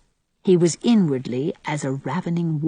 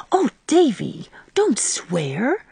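higher Oh, then a dismayed whispered plea; dismayed gasp; 6 semitones higher, much slower than usual. A narrator's reading. Little sister shocked at her brother swearing, gasped whisper. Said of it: "gasped Dora in dismay".

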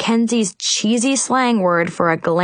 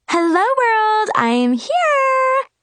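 very high, slow squeal; slower than usual. A narrator's reading. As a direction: squeal an entrance to the party. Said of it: "someone squealed".

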